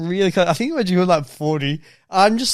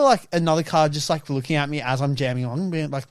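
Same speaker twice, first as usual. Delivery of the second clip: ordinary talk, nothing marked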